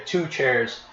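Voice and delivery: ordinary talk, nothing marked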